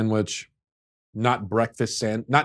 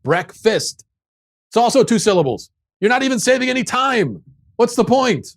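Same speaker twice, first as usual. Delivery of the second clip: rising higher and louder in mock fury; exasperated rant; 11 semitones higher, 6 dB louder than usual. Ranting at Australians over brekkie, building mock fury. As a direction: build to a shrill exasperated peak.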